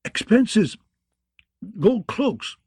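choked words between coughs; coughing between words. Acted. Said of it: "Lord Giles coughed".

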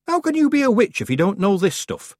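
high, puzzled townsman; baker. A narrator's reading. Baker puzzled at the young witch. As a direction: wonder at her ignorance.

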